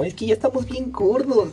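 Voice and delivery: a mock whiny little-kid complaint; hint of younger brothers whining; brothers complaining. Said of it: "pero me dicen como".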